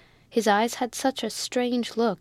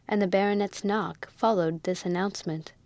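plain reading voice

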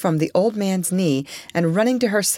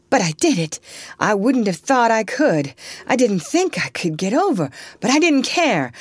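raised, agitated outpouring; wild; 6 semitones higher, 3 dB louder than usual. A narrator's reading. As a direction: pour it out in desperate insistence. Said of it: "said Eliza wildly".

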